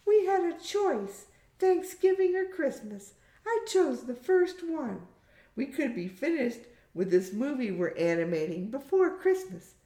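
put-on very high girlish voice; high-pitched young animator; Hamish, young daughter. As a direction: explain it to the grown-ups in a high daughter's voice.

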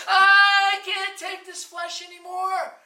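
high, drawn-out shout to God; desperate cry. Acted. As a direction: cry out loudly at the breaking point. Spirit cries out to God.

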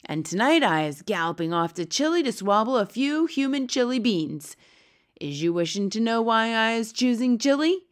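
loud giant announcing his plans; blood bottler, boastful giant. A narrator's reading. Blood bottler tells the B F G his plans, boasting loudly.

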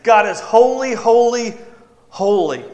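emphatic proclaiming repeat; emphatic repetition. Shown as a demonstration. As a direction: ring it out with force. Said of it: "That gets italicized".

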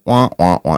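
low, droopy wah wah wah; mock sad trombone sound. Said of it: "The S N L thing".

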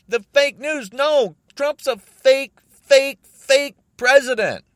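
loud, high outburst peaking on the repeated fake; shouted, slowed denunciation; angry shout. Real, not acted. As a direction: yell it in real fury. Raging about Trump as fake.

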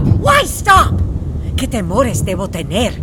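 loud, high theatrical defiance; hammy stage actor. Acted. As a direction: defy fate like a stage Hamlet. Defiant Hamlet speech to sister.

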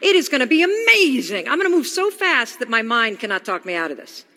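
loud, thrilled burst of a new idea; excited revelation. Retold or imitated. Inspired by a rocket launch, loud and thrilled. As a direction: shout it like the answer just landed. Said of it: "I see this rocket launch and I say".

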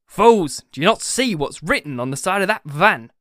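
loud outburst easing into urgent demand; exasperated shout. A narrator's reading. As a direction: shout in frustration at the crowd. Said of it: "shouted Benjamin".

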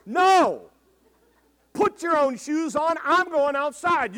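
loud, slow, firm refusal; firm grandpa. Retold or imitated. Grandpa refusing his granddaughter's plea, loud and firm. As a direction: refuse kindly but without budging.

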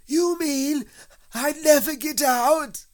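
very high, loud, drawn-out dismay; alarmed wail. Acted. Pooh learns he may never get out, alarmed. Pooh fears being stuck forever.

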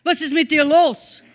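loud, challenging demand; loud confrontation. Retold or imitated. Other man confronting the shouting stranger, loud challenge. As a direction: call the harasser out sharply. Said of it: "sagt zu diesem Mann".

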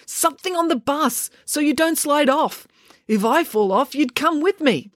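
a touch of high-pitched urgency; urgent instruction. A narrator's reading. Joe keeps Finn from sliding off, urgent instruction.

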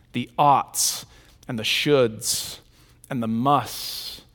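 quiet, slow, each word set apart; slow deliberate list. Shown as a demonstration. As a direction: weigh each word softly. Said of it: "slow measured listing".

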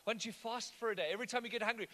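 ordinary talk, nothing marked

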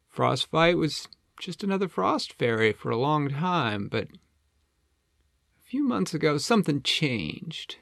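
high, swooping penguin storyteller; Flippo. Acted. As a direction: recount the trouble as a chatty penguin. Flippo tells the backstory.

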